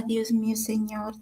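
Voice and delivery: hushed imitation of her crying prayer, trailing into a whisper; mild retold weeping. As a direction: softly re-enact the broken plea. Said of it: "Yo así mismo lloraba".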